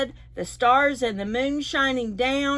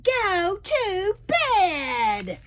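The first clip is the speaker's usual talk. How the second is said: mock holler; 8 semitones higher, much slower than usual. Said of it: "An old man is hollering".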